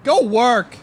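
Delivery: loud retort; shouting. Acted. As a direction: bark it back. Acted quarrel reply.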